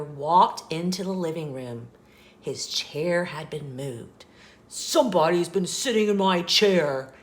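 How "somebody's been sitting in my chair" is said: loud, growly bear voice; Papa Bear's loud growl. A narrator's reading. Papa Bear sees his chair was moved, loud growl. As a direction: growl it loudly like a big bear. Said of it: "in his loud, growly voice".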